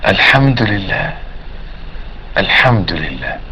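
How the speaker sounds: soft, slow praise with long pauses; unhurried dhikr. Shown as a demonstration. Preacher showing how to praise God after prayer, soft and slow. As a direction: murmur each praise low and let it fade.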